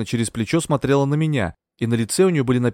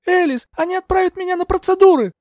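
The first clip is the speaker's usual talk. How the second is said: very high, loud appeal; high-pitched, frantic warning; panicked cry; 5 dB louder than usual. A narrator's reading. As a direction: cry out to her for rescue. Heroine cries for help.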